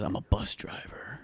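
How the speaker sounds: very soft and slow; unhurried imitation, barely voiced; calm. Cousin answering what he does now, calm. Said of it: "he sounded so calm".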